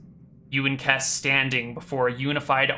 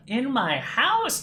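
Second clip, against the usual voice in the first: baffled questioning; 9 semitones higher, slower than usual. Retold or imitated. Mimicking failed attempt to describe dream.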